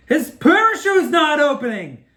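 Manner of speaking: high, loud, slow panicked yell. Retold or imitated. Boy in the plane watching dad fall, panicked yell. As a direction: scream it as the chute won't open. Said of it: "I'm starting to freak out".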